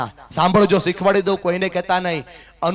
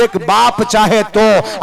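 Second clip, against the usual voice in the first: drawn out and weighty, every word placed; slow emphatic climax; 5 dB louder, much slower than usual. Acted. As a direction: land the moral slowly. Climax line of the sermon.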